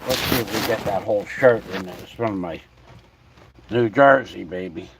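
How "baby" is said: ordinary talk, nothing marked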